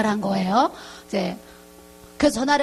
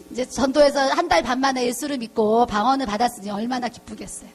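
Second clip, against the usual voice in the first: normal talking voice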